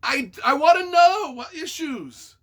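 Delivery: usual speaking voice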